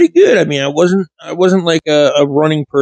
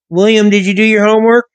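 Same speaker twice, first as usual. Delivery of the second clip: teacher voice; 7 semitones higher than usual. Retold or imitated. Teacher voiced, asking pupil.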